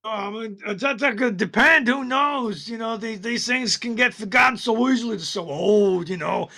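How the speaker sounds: high, sly goblin dodging the question; evasive goblin. Acted. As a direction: stall and upsell like a goblin.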